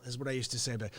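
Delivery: ordinary talk, nothing marked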